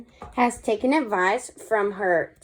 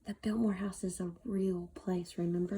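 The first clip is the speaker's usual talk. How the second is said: whisper; 3 semitones lower, 9 dB quieter than usual. Real, not acted.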